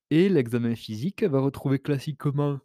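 speaking through a yawn; words pulled out of shape by a yawn. Real, not acted. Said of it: "oh, excusez-moi, je bâille un petit peu". (en bâillant)

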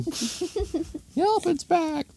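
very high falsetto wail; hammed-up wife voice; exasperated wife. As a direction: drag it out in a high, slow whine. Upset wife answers husband.

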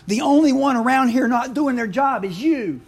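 retold scream at God, half acted. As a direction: yell it up at heaven in frustration. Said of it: "he screams out to God".

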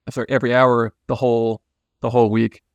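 normal talking voice

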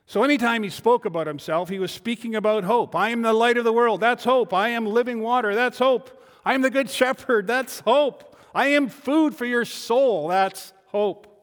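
loud, high, emphatic preaching; full-force preaching. Acted. Pastor declaring Jesus' I am sayings, loud and emphatic. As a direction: drive each "That's hope" home to the hall.

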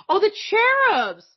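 high, loud, slow exclamation of sudden recall; excited realization. Retold or imitated. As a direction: blurt it out as it clicks. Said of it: "I'm like".

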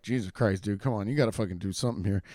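low, soft, quick grumble; weary mutter. Retold or imitated. Fed-up security guard urging the fighter on, low quick grumble.

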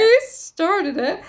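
high, drawn-out mock excuse; making it sound better. Copying the suggested excuse for the killing, mock justifying. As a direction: whine it slowly, pitched right up. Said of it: "make it sound better".

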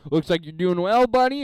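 bright, loud greeting to a friend, pitch jumping up; hey buddy, loud and up; upbeat call out. Real, not acted. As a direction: cheer him on warmly. Shout out to friend.

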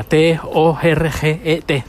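T O R G E T spelled slowly letter by letter; letters of Torget spelled out slowly; spelling it out. Shown as a demonstration. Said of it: "pero se escribe Torget".

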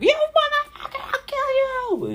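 high, shrieking mock girlfriend threat; hammed-up screeching jealous girlfriend; angry girlfriend. Voicing fighting girlfriends.

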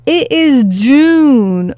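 slow loud announcement; proclaiming. Real, not acted. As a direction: stretch it out loudly.

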